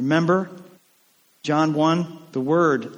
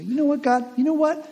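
challenging; 11 semitones higher than usual. Retold or imitated. Challenging God, mock bargaining.

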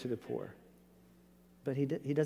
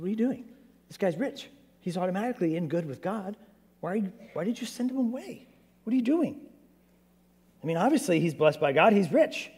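higher, incredulous questioning; baffled disciples; 5 semitones higher than usual. Retold or imitated. Disciples questioning Jesus for sending the rich man away, higher and incredulous. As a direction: push the pitch up in disbelief. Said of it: "the disciples maybe bought into that".

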